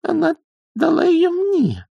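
tiny, very high, slow protest; squeak. A narrator's reading. Young witch clutches the wand, squeaking in protest. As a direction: squeak it out at the top of the voice. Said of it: "пискнула она".

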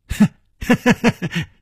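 high, mocking cackle; snort, then cackle high; villain's laughter. A narrator's reading. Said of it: "白玉魔笑着说".

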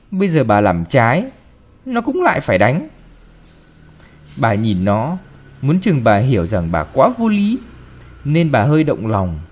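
plain reading voice

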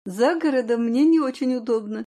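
ordinary delivery, nothing marked

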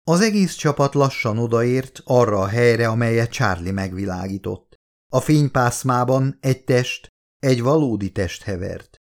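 usual speaking voice

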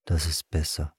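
low, soft murmur; subdued mutter to himself. A narrator's reading. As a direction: mumble it quietly, low in pitch. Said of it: "murmelte er schließlich".

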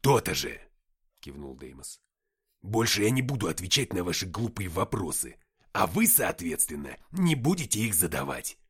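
loud, firm laying down law; stern demon voice. A narrator's reading. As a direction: lay down the law.

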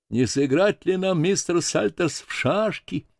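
high, timid invitation; plaintive meek voice. A narrator's reading. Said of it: "обычного жалобного шёпота". (жалобно и робко, высоким голосом)

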